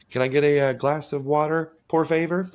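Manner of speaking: por favor said high and loud in a hammed-up Spanish accent; mock Spanish accent. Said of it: "He's always like".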